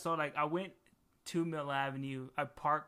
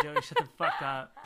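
louder mock yell through laughter; laughing mock yell; 6 dB louder than usual. Repeating her line to Joey, louder and laughing. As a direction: shout him down, cracking up. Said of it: "I was like".

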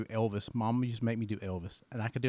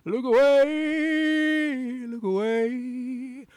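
slow, crooning [name], soaring much higher and louder on look away; 5 semitones higher, 5 dB louder, much slower than usual. Acted. Dad sings [name] for his guest as [name]. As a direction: sing it slow as [name], building to a big high climax. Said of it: "I could do it as [name]".

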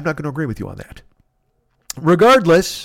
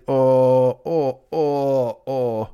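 sick moaning; 3 semitones lower, much slower than usual. Retold or imitated. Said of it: "I would get sick and I'd be like".

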